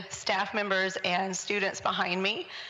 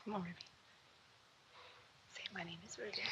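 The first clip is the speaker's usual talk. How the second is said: whispering; 21 dB quieter, much faster than usual. Real, not acted. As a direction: whisper the line for her to repeat. Adult prompting a shy child.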